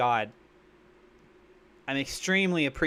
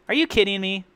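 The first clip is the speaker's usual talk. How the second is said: normal talking voice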